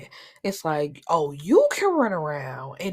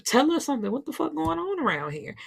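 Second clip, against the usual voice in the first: high-pitched demand for answers; mock incredulous plea; exasperated pleading; 7 semitones higher than usual. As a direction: act out baffled kids pressing for an explanation. Acted kids demanding answers from dad.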